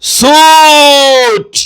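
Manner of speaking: سوٹ stretched extremely slowly and loud; teacher modelling سوٹ; slow careful pronunciation. Shown as a demonstration. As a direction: draw out سوٹ for the class. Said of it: "اس کا تلفظ".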